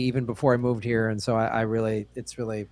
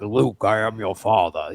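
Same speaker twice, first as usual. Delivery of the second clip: lower, put-on movie villain voice; Darth Vader impression; 4 semitones lower than usual. Friend teasing comic about big voices, villain impression. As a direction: do the famous dark-father line in a deep voice. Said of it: "You don't need to be like".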